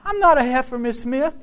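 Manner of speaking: put-on high-pitched small child's voice; little girl protesting. First grader corrects her teacher, startled high protest. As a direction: object in a squeaky, taken-aback child voice. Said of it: "the little girl quit crying. She was like".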